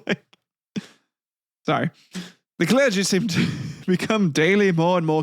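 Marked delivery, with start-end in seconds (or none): none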